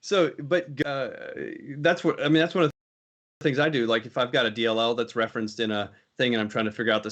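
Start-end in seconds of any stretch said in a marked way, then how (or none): none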